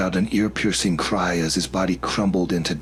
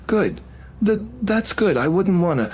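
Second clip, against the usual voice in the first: stammering, higher, nervous; 13 semitones higher than usual. Acted. Jacek anxiously reassures Fiona, nervous stammer. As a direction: trip over the words, uneasy. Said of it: "he swallowed".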